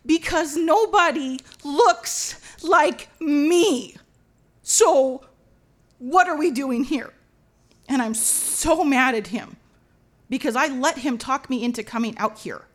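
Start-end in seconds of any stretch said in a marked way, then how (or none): 0.1-7.1 loud, drawn-out protest; mad, frustrated. Retold or imitated. Wife telling husband nobody here looks like her, mad. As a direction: hammer each word in high exasperation. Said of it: "I'm just so mad".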